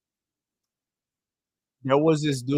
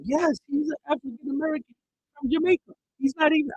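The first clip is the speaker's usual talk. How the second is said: high-pitched know-it-all imitation; mock patronizing; 11 semitones higher than usual. Imitating a lady overruling her Jamaican coworker, patronizing. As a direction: insist in a high, condescending voice.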